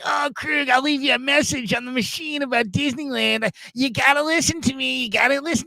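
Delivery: high-pitched, eager advice-giver impression; Ralph. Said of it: "I'll never forget, though, when I told him".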